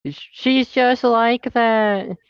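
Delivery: high, very drawn-out mimicry; slow, high-pitched dismissive imitation; mocking boss. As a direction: stretch it out high, mocking the excuse. Mimics boss excusing Betty.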